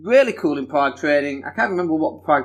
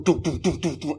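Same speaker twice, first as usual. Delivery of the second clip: rhythmic mouthed gun bursts; imitated machine-gun fire; 5 dB quieter than usual. Man recalls firing a big machine gun, imitated shots.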